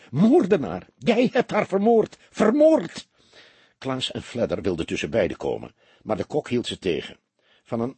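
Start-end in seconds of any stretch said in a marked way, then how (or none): 0.1-3.1 rising, much higher and louder shrieks; screaming accusation. A narrator's reading. Femi pounding the killer's chest, screaming in fury. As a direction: build into a hysterical scream. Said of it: "gilde ze".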